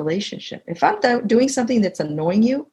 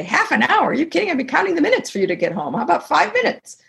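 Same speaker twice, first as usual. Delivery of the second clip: higher, fast, mock incredulous; incredulous protest; 6 semitones higher, faster than usual. Wife haggling with her husband over unwinding time, incredulous. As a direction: scoff at half an hour and haggle it down. Bargaining, shocked at half an hour.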